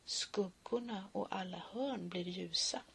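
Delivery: soft hushed whisper; hushed poem ending. Acted. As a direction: let it fade into a whisper.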